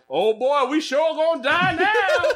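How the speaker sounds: high, slow hammed-up Southern drawl; mock Southern accent. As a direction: play an African guide suddenly sounding Southern, laughing. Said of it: "You speak English with a Southern accent?".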